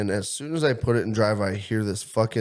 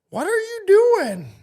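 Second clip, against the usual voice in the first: put-on high, slow old lady voice, much louder; 6 dB louder, slower than usual. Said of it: "And she looks, she goes".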